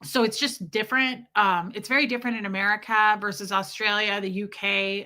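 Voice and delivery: ordinary talk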